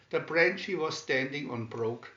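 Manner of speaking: usual speaking voice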